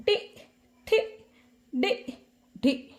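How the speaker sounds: टि, ठि, डि, ढि as separate short syllables; syllable drill. Shown as a demonstration. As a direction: model each of टि, ठि, डि, ढि with a pause between. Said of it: "इसका उच्चारण घर में करोगे".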